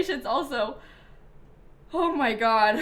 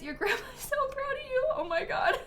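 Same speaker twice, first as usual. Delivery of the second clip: crying laugh; 10 semitones higher than usual. Real, not acted. Thanks chat, tears about grandma.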